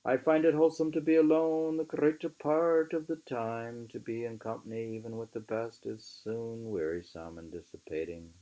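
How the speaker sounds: measured, emphatic book reading; reading aloud. Acted. Reading Thoreau aloud to a friend, measured and emphatic. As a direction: read the old essay aloud with weight. Reads next passage.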